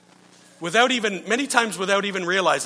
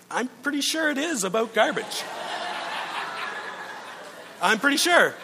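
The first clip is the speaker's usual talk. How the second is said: laughing; 5 semitones higher, 4 dB quieter than usual. Retold or imitated. Laughing disbelief at wife's claim.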